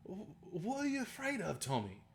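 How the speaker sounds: high, put-on movie tough-talk quote; acted-out Snatch line; movie character impression. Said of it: "he goes".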